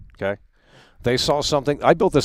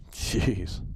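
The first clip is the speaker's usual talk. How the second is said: disgusted mutter; 2 semitones lower, 11 dB quieter, much slower than usual. Real, not acted. Muttering after the rant.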